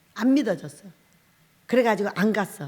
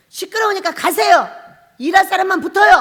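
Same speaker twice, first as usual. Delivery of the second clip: higher, louder shooing people off; bossy dismissal; 7 semitones higher, 9 dB louder than usual. Retold or imitated. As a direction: send the chatterers away firmly. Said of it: "딱 이러고 다 내쳐버려요".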